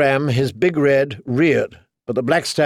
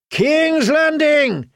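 very high, drawn-out rallying cry; slow, high war cry without full volume; ragged battle cry; 13 semitones higher, much slower than usual. A narrator's reading. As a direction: cry it out like soldiers charging. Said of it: "his men cried raggedly".

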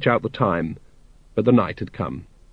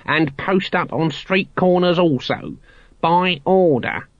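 high, slow officious declaiming; loud official proclaiming; 7 semitones higher, slower than usual. A narrator's reading. Guard gives the Watch orders, loudly and officiously. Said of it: "said the guard loudly".

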